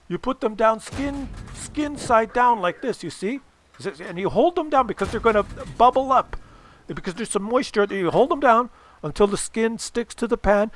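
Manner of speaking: dad stressing it's very important; insistent dad lecturing. Retold or imitated. Dad lecturing, slowing for emphasis.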